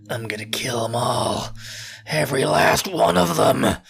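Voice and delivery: slow, rasping menacing vow; Eren Yeager. Retold or imitated. Echoing Eren's vow of revenge, slow menacing growl. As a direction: growl it out slowly, word by word. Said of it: "Who, who says that?".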